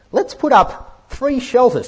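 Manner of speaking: a clumsy, eager Peter offering three tents; excited Peter blurting out an idea. Retold or imitated. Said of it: "he comes out with this".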